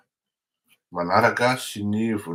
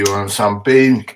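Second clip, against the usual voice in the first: words pushed out through a burp; talking while burping; 9 dB louder than usual. Real, not acted. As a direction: burp mid-sentence while speaking. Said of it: "J'ai roté, je suis désolé".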